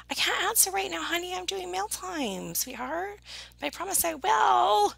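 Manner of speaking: high, sugary put-off; high-voiced, coaxing excuse; sweet brush-off. Real, not acted. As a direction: pitch it up to placate a sweetheart. Putting off someone while doing mail.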